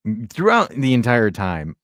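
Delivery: usual speaking voice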